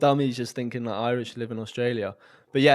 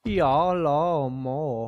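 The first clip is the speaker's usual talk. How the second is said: very slow, drawn-out faux Hong Kong speech; mock Hong Kong accent; much slower than usual. Inventing an accent for his mates, mock Hong Kong accent. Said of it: "you're from Hong Kong".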